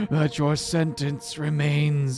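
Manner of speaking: put-on wheezing, gasping old woman; wheezy voice. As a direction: wheeze through each phrase. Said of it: "that wheezy voice".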